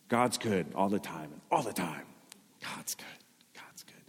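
fading from a soft chant to a whisper; mock cheerful slogan. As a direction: let the slogan dwindle away until it's barely breathed.

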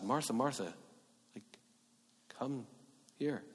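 soft, drawing her close; almost hushed, loving summons; tender call. Acted. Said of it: "it's for closeness".

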